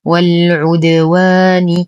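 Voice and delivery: والعدوان recited slowly with a clear open و; slow tajweed recitation. Shown as a demonstration. Teacher recites the word for students, slow measured chant. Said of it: "وصلا".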